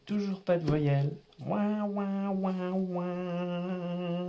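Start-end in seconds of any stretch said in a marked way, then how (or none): 1.4-4.3 high fake baby whimper; hammed-up fake sobbing; mock crying. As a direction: whine like a sulking baby. Mock crying over no vowels.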